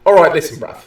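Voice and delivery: mock British street accent. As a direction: square up like a comic British bruv. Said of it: "I respect your accent".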